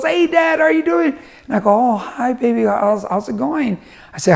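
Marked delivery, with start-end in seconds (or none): 0.0-1.2 very high-pitched imitation of a cheerful little girl; happy-go-lucky. Daughter greets her dad after soccer, happy-go-lucky. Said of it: "she's so happy-go-lucky". 1.7-3.8 put-on higher, gentle talking-to-a-child voice; tender greeting. Dad greets daughter after her game, tender.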